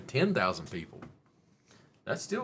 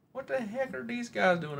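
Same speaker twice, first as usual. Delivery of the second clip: high, bemused cartoon voice; high silly voice watching the guys; comic high voice; 14 semitones higher, 3 dB louder than usual. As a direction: ask it like a baffled cartoon character.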